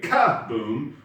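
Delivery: front-loaded "ka-boom", first syllable exploding; stress on KA. Shown as a demonstration. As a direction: hit the "ka" hard in "ka-boom", let "boom" drop. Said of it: "put the emphasis on the ka".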